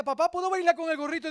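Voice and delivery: put-on a child pleading sweetly; daughter's voice. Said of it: "Y, y Daniela".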